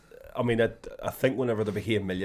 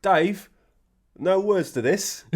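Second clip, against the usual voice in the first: high, slow and louder, puzzled; mock dim Trigger; 9 semitones higher, 7 dB louder, much slower than usual. Trigger asking Rodney about the music, slow high puzzled. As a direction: play it slow and high like a slow-witted man. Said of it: "Trigger's like".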